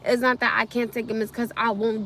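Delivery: normal talking voice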